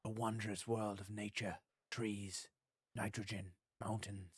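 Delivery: hushed, soft nature film narrator; nature documentary. Pretend. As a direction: murmur like a wildlife documentary host. Game vlog parodying nature film.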